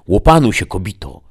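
loud, high scolding shout at the wife; angry outburst. A narrator's reading. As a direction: snap at her to calm down. Husband slams table, scolds wife. (gniewnie, podniesionym głosem)

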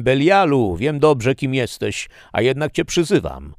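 high, loud summons; calling out. A narrator's reading. Prisoner knowingly invokes the demon Belial, calling out. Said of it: "zawołałem".

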